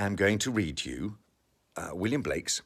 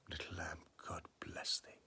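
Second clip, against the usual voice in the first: softer, breathed whisper of a blessing; 15 dB quieter than usual. Acted. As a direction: let the last blessing fade to a whisper. Final whispered repeat.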